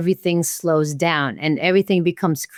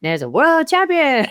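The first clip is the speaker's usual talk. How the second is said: high, excited retold announcement; big news in a raised, thrilled pitch; amazed announcement; 9 semitones higher than usual. Retold world champion announcement.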